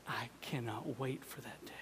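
soft unvoiced whisper; whispered longing. Real, not acted. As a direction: whisper it like a secret hope.

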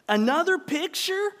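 high, loud indignant question; sharply rising, irritated disbelief; growled. A narrator's reading. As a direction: read it as a disgusted wife's outburst. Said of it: "Sarah Ruth growled".

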